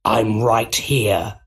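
slow, creepy villain voice; sinister Goblin. Acted. Goblin reveals himself to Osborn, sinister. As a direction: draw it out like a lurking villain.

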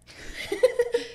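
airy squealing laugh; wheezy giggle burst; breathy high laugh. Real, not acted. Laughing at being stood up.

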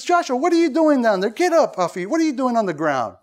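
mock scolding, starting high; sarcastic Lord. God asks Joshua why he's down there, sarcastic. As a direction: act out a sarcastic rebuke from God, easing down. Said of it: "being sarcastic with them".

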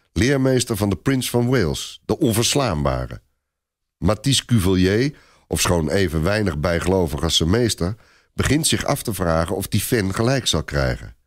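ordinary talk

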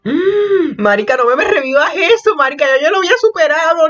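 high-pitched dramatic wail; lamento dramático. Real, not acted. Wails over a painful memory. (lloriqueo exagerado y agudo)

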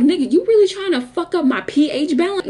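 high-pitched indignant challenge; mad, outraged. Retold or imitated. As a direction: scold him high in retold outrage. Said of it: "I was just so mad".

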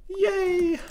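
squealed yay; happy squeal; high cheer. Real, not acted. Survived the escape.

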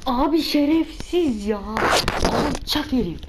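loud, high, slowed angry curse; frustrated outburst. Real, not acted. Gamer cursing the enemy soldiers, loud angry outburst. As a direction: blurt it out at the cheating enemies.

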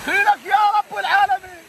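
high gasping cries from the cold; in ice cold water. Real, not acted. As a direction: yelp through the freezing shock.

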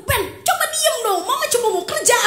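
put-on high, laughing exasperated plea to a child; half-laughing, half-whining mock scolding; mother pleading with her clingy toddler, exasperated and laughing. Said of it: "ketika saya waktu itu kesel banget".

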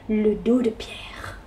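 hushed whisper at the end. A narrator's reading. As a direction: breathe the words out. Narrator whispers.

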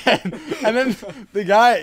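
ordinary talk, nothing marked

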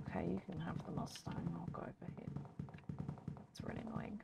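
defeated sulky whisper. Real, not acted. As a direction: whisper your surrender, sulking. Giving up Mustang, muttering.

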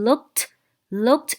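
"looked" ending in a crisp t sound. Shown as a demonstration. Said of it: "ed ออกเป็นเสียงตัว t".

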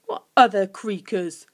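mildly curious creature; curious question. A narrator's reading. Guff puzzled about other creakers, curious. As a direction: ask with a touch of curiosity. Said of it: "said Guff curiously".

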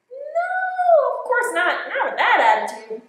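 high then loud, firm no; a hint of a scolding teacher; mock indignation. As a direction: huff it out. Pigeon answers, then teacher remark.

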